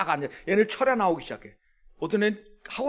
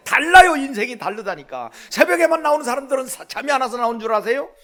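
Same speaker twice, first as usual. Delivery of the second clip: high, loud urging of the congregation; fired-up preacher's raised voice; impassioned preaching; 6 semitones higher, 6 dB louder than usual. Real, not acted. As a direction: exhort them with full-force conviction. Preaches at full force.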